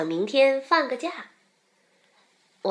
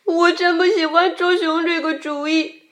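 lightly mock-tearful, higher-pitched whine; 6 semitones higher, 3 dB louder than usual. A narrator's reading. Xiaopang tells the rabbit he dislikes the bear-catching idea, mildly tearful. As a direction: complain as if on the edge of crying. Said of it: "小胖哭着说".

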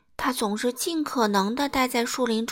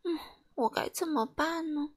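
soft, high, helpless little voice; dejected; 6 semitones higher, 7 dB quieter than usual. A narrator's reading. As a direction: think aloud to yourself, downhearted. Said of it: "感觉十分沮丧". (沮丧地轻声自语)

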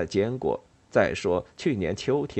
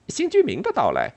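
higher-pitched startled squirrel asking; quick eager question; 6 semitones higher, 4 dB louder than usual. A narrator's reading. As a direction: jump on the question, voice lifting. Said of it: "松鼠飞快地问道".

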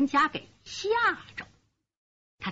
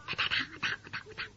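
imitated footsteps; 6 semitones higher, 12 dB quieter, much faster than usual. Imitating kneel-walk steps.

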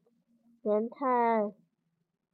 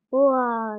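loud roar "wa" as he charges; dinosaur roar; 5 dB louder than usual. Acted. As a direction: let out a battle roar, louder. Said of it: "吼叫着".

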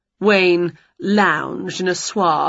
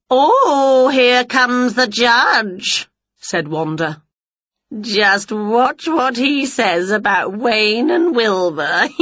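slow, gloating witch voice; smug witch; 4 semitones higher, slower than usual. A narrator's reading. Wanda gloating to Winnie as the judge arrives, slow. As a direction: drag it out, sure she'll win.

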